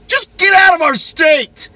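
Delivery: high, loud, slow shout out the window; a real road-rage yell, pitched high; angry shout. Retold or imitated. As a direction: yell him out of the state. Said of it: "I, like, do this whole".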